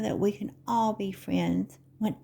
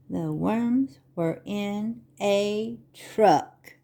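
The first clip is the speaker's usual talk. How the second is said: slow, sing-song picture-book reading; Vorlesen; slower than usual. Acted. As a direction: read to little children, stretching the words with big pauses. Reads picture book to children.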